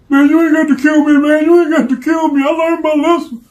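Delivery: high, fast pleading voice, imitating the beaten man; begging for mercy. Beaten man begging for his life.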